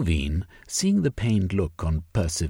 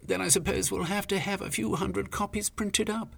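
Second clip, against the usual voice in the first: softer, high, reluctant giving in; grudging concession; 13 semitones higher, 5 dB quieter than usual. Acted. Sylvia grudgingly conceding to Velvein, soft and reluctant. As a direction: swallow your pride and say it. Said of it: "At length she managed to say".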